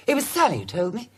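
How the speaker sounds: usual speaking voice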